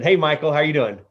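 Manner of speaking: ordinary talk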